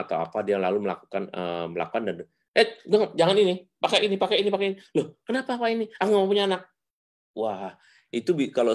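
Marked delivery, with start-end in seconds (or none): 2.5-6.6 acted quarrel. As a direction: play both spouses, pitched way up. Acted couple quarrel in story. (suara tinggi bertengkar)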